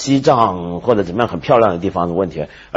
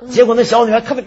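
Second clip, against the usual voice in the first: ordinary delivery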